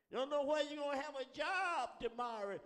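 impassioned exhortation. Real, not acted. Preacher to congregation fearing for jobs, high and forceful. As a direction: press the worry hard on the listeners.

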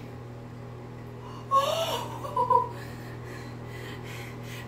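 sharp high gasp with a laughing cry; shocked gasp. Real, not acted. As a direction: gasp at the icy water. Ice water poured over her.

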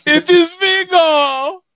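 high, loud, slow character cry; hammed-up proclamation, drawn out high and loud; Vigo. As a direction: announce yourself as a grand villain, wailing it out slowly. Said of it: "Yes, it's".

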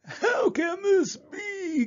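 very high, drawn-out wail; hammed-up cry of dismay; anguished cry. A narrator's reading. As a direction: wail it slowly in despair. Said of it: "cried Roy".

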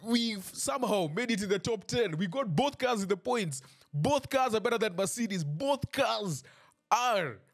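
high-pitched fan hype; hyped up. Real, not acted. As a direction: sell it like the best news of the weekend. Hyping his team's top-ten finish.

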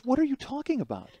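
high-pitched baffled question; bewildered. Retold or imitated. Friend's wife to Karen at intermission, bewildered. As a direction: imitate a woman taken aback.

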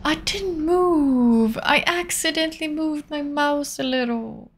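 high, drawn-out wail; wailed protest. Real, not acted. Player protesting an accidental mouse move, drawn-out wail. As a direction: wail it out long and high, then let it sink.

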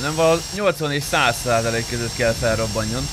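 usual speaking voice

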